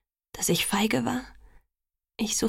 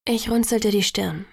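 plain reading voice

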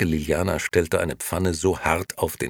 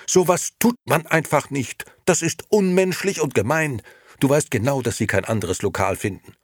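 high, loud indignation; incensed; 10 semitones higher, 6 dB louder than usual. A narrator's reading. Liliana reproaches Nino for evicting the old men, incensed. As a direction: scold him in outrage. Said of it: "erwiderte Liliana aufgebracht".

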